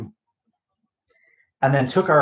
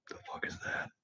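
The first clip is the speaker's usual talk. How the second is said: startled whisper; 19 dB quieter than usual. Real, not acted. Startled by something mid-sentence.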